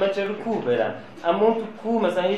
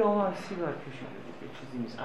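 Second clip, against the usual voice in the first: much softer, hushed voice; hushed excuse; 11 dB quieter than usual. Acted. Mother soothes the father about the son's smoking, hushed. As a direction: play it down almost in a whisper-quiet voice.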